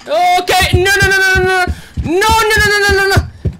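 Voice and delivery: frantic high no no no; real panic, rising pitch; panicked. Real, not acted. Panicking as everything shakes.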